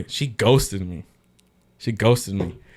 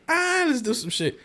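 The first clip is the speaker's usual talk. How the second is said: put-on much higher playful girl voice; mock girl voice. Guy mimics the girl inviting fun, pitched high. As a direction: tease it like the girl did.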